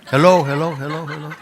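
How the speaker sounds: high, loud hello calls; loud calling. Acted. Seeker calling into the empty cave, loud. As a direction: call out into the dark, high.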